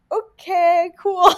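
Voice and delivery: high-pitched, sing-song dismissal; mock unimpressed. America reacting to a dumb secret, mock unimpressed.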